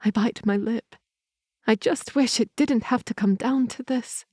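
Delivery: usual speaking voice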